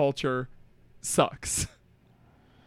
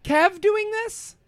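high, slow incredulous question; 10 semitones higher, much slower than usual. Real, not acted. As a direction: sound like you can't believe it. Disbelief about Kev.

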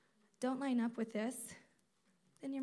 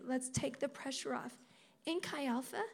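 normal talking voice

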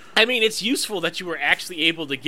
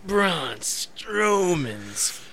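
very slow, drawn-out deadpan; much slower than usual. Pretend. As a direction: drag out each word slowly. Slow drawn-out joke reading.

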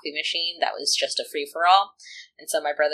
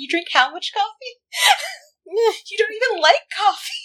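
incredulous dad. Retold or imitated. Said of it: "And Dad is like".

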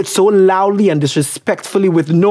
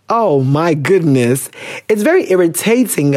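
normal talking voice

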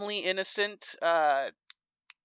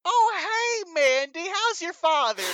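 high, exaggerated friendly hello; oblivious high-pitched small talk; chirpy in-character greeting; 12 semitones higher, 3 dB louder than usual. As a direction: play dumbly innocent, pitched up. Greets glaring Mandy in character.

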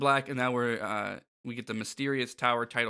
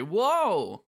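pitched-up cartoon whoa; amazed group exclamation; 7 semitones higher, 4 dB louder than usual. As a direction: voice the trio's amazement, swooping up. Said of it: "Goof Troop say".

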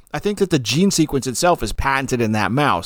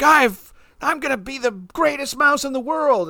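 squeaky high mouse voice, puffed up and boastful; a cocky cartoon mouse bragging; proud little mouse; 12 semitones higher than usual. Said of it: "the poor mouse who's like".

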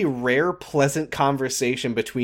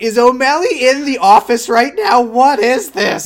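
high, loud alarmed disbelief; freaking out; 9 semitones higher, 8 dB louder than usual. Retold or imitated. Co-host reads out their panicked notes on the show, freaking out. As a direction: blurt it out in a panic, slowing on the last question. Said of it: "I'm freaking out".

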